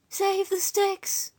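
high, very slow, voiced stage whisper; whispering sick woman. A narrator's reading. Sick Lily clutching Oswald's arm, high slow plea. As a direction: draw it out high, as a feeble whisper. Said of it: "clutched Oswald's arm and whispered".

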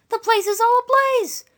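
high, loud, drawn-out exclamation; alarmed country woman. A narrator's reading. Eliza telling Lily of the fire, high loud alarm.

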